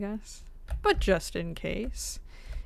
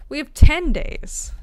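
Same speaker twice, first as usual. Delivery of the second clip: louder and higher, a little slower; excited; 7 semitones higher, 13 dB louder than usual. Real, not acted. Streamer realizing how much time remains, excited. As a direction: announce the discovery with glee.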